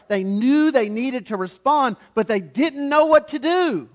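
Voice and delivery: loud, high impassioned sermon; fervent preaching. Real, not acted. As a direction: drive the point home from the pulpit. Preaching at full force.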